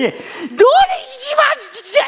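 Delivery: hysterical high-pitched wail, very loud; hammed-up imitation of mom losing it; screaming. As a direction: shriek for the grandkids, words dragged out. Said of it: "막 소리를 지르는 거예요".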